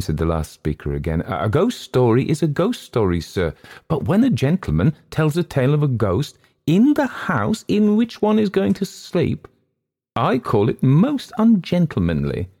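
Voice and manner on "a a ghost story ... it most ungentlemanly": high, emphatic complaint, stretched key words; rising indignation over a ghost story; indignant guest. Acted. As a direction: protest in a high, affronted voice.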